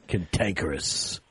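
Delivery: drops way down, slow and gravelly; deep mock voice. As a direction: growl the word out low and slow. Repeats word in put-on voice.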